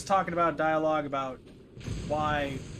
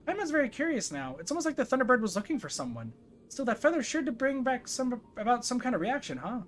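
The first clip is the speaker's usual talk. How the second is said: higher-pitched inquisitive companion; Paimon, curious; 8 semitones higher than usual. Acted. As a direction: wonder aloud in a high character voice. Voices game character Paimon.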